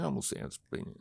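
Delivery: breathy hushed aside to himself; whisper. Retold or imitated. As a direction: whisper it under your breath. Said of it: "I'm, like, going".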